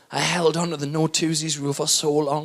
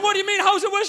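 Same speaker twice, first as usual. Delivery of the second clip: panicked; 13 semitones higher, faster than usual. Retold or imitated. Panicked question to wife.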